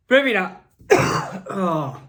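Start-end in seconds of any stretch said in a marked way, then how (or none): 0.9-1.4 short breathy cough; a quick unvoiced cough; dry cough. Real, not acted. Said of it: "I'm not editing this out".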